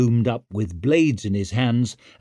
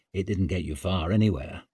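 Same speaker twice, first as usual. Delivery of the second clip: usual speaking voice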